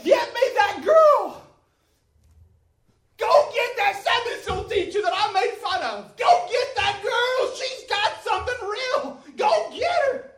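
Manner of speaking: high, loud, slow demand; desperate shout. Retold or imitated. Dying woman begs to fetch the Sunday school teacher, desperate high shout.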